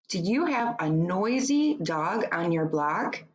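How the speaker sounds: usual speaking voice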